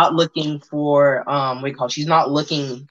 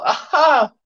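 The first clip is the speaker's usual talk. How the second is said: triumphant aha; 9 semitones higher, 3 dB louder than usual. Acted. NPC triumphant shout.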